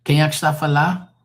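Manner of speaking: a hint of someone picking up the phone; phone answer. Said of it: "when somebody answers the phone".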